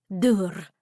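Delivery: "Dur" with the final R rolled. A narrator's reading. Pixie proudly giving his name, R rolled in "Dur". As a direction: roll out the R at the end of "Dur". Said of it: "stating the name with a rolling R".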